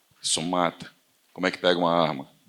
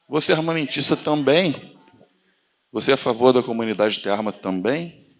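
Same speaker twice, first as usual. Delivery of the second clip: feigned innocent question; 6 semitones higher, 5 dB louder than usual. Retold or imitated. Acted debate question to leftist.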